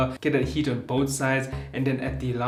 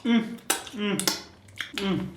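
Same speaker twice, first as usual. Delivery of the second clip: savoring mmm; 8 semitones higher, much slower than usual. Real, not acted. Chewing the wing.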